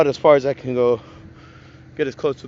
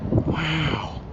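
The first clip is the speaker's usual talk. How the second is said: long, awed celebrity-catchphrase wow; Owen Wilson wow. Real, not acted. Vlogger marvelling at a clear mountain view, Owen Wilson-style wow. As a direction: let the wow drift out in amazement. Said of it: "I'm sounding like Owen Wilson".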